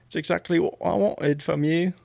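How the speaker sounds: high Southern attempt slipping into English; botched Southern-English accent; failed Southern accent. Shown as a demonstration. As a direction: aim for Southern, land on English. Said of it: "the word what goes English for me".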